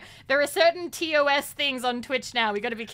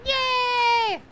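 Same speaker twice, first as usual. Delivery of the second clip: high, loud, drawn-out yay; gleeful shout; excited cheer; 11 semitones higher, 5 dB louder than usual. Real, not acted. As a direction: let out a long squealed cheer. Cheering during the game.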